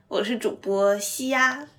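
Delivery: ordinary talk, nothing marked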